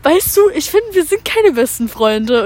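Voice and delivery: put-on loud, drawn-out drunk declaration; imitating drunk friend. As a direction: play the tipsy friend, slow and loud. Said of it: "richtig betrunken angefangen zu sagen". (betrunkene Freundin, laut und gedehnt nachgemacht)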